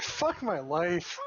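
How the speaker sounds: slow, loud groaning exasperation; exasperated. Real, not acted. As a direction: drag it out in despair. Exasperated reaction to bad news.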